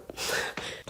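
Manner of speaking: short breathy nervous laugh; uneasy little laugh at herself. Real, not acted. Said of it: "c'est un rire nerveux".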